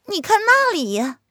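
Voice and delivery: put-on high girlish maid calling out; young maid voice. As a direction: call to your mistress, high and eager. Maid urges lady to look. (高声呼唤的小丫鬟)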